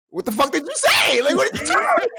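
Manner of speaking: loud, high furious yell at his friend; retold angry shout. At his homie who was asked about robbing him, a furious high shout. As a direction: demand it, outraged and shouting.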